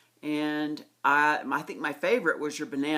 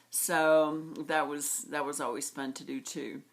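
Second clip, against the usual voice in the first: ordinary talk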